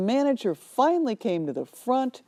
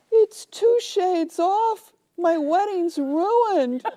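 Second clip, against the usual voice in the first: much higher, slow hammed-up wail; distraught bride; 11 semitones higher, 3 dB louder, much slower than usual. Bride wailing to the clerk that her wedding is ruined. Bride wails over dress.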